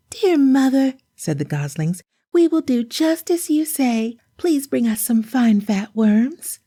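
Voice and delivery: put-on high eager children's voice; small chicks promising to be good; little goslings. Goslings answer mother.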